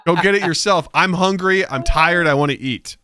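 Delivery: hammed-up tired dad ranting; mock exasperated dad. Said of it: "I was like".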